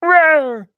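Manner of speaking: high loud playful rawr; mock roar. Gamer voicing a character's roar, high loud mock roar. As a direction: roar like a silly monster.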